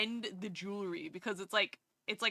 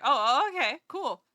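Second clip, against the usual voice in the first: surprised; 4 semitones higher, 9 dB louder than usual. Retold or imitated. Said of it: "I was like".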